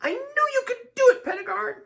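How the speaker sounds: very high, quick gushing praise; high, flattering character voice; Lydia purring. A narrator's reading. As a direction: coo it fast in a high-pitched voice. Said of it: "purrs Lydia".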